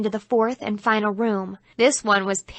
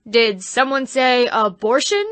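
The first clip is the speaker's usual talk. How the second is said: put-on devil voice, a bit louder; hammy devil; 3 semitones higher, 5 dB louder than usual. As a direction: pounce on the word like a stage devil. Devil actor enters.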